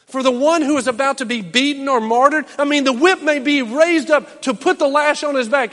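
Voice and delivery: loud, high, fervent preaching; preaching at full force. Acted. Preacher stirring the church, loud and fervent. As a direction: drive it home to the congregation.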